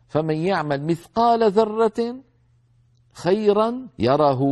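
Quran recitation. Shown as a demonstration. Teacher reciting the verse to students. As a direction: recite it slowly with tajweed.

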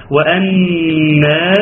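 long sustained hum on the doubled ن of وأنا; ghunna held. Shown as a demonstration. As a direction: draw out the nasal noon in وأنا. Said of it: "غنة تغني مقدار حركتين". (غنة ممدودة على نون وأنا)

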